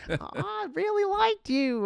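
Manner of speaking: very high, drawn-out mock Wisconsin girl; attempted Wisconsin accent. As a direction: stretch it out in a squeaky fake Wisconsin accent. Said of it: "I can't even do the accent. I wanna do like".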